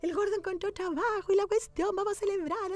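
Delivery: high, bubbling retold excitement; excited. A woman telling her friend her husband found work, high excited gush. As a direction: gush about the husband's new job. Announcing husband got a job.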